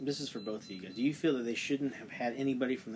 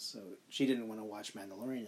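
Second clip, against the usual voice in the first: normal talking voice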